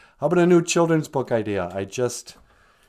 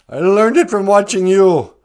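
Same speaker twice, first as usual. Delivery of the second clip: put-on loud quoted kid line, a bit higher; 7 semitones higher, 10 dB louder than usual. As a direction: belt out the famous ad line like the kid. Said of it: "John Redcorn says".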